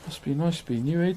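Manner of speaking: usual speaking voice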